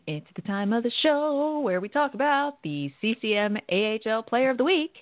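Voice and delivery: usual speaking voice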